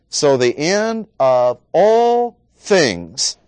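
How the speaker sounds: "all" drawn out high and loud, slowly; slow, lifted emphasis on "all"; stress on ALL. Shown as a demonstration. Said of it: "That's the emphasis".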